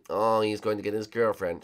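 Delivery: normal talking voice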